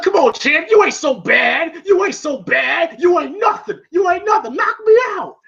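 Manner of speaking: taunting, pitch climbing to a mock shout; trash-talk like a cocky boxer; shit talking. Rocky goading Clubber mid-fight, shit talking. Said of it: "Rocky's shit talking".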